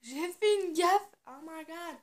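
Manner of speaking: loud, high, drawn-out cry of dismay; dismayed outcry. Real, not acted. Vlogger reacting to botching her lipstick, dismayed outcry. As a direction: exclaim over a blunder, then a high "oh my god".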